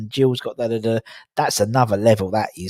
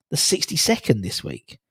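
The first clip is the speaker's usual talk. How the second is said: normal talking voice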